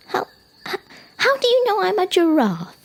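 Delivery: put-on high, loud child giraffe, halting start; very curious. Young giraffe questioning a talking rock, high and curious. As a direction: stammer into an amazed high question. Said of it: "very curious".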